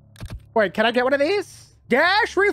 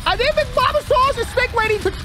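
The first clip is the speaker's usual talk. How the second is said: panicked; 9 semitones higher than usual. Real, not acted. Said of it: "I'm panicking is what I'm doing".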